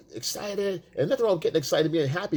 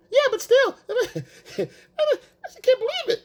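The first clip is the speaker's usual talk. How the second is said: much higher, slow, laughing amazement; mock gushing, laughing; 4 dB louder, slower than usual. Boyfriend still gushing while she shrugs it off, much higher and slow with laughter. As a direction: stretch it out, laughing through the fake awe.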